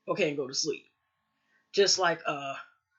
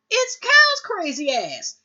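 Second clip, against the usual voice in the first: dramatic reveal; 11 semitones higher, 6 dB louder, slower than usual. Real, not acted. Announcing the surprise visitor.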